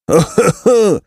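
high-pitched acted guffaw; shrieking mock laugh of a gang; shrill bandit laughter. A narrator's reading. Bandits laugh at the rhymer, shrieking laughter. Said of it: "Wrzasnęli zbójcy przeraźliwym śmiechem".

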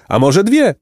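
high, playful question; cheerful teasing. A narrator's reading. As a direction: ask it with a mild wink in the voice. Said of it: "mrugnąwszy wesoło zapytał".

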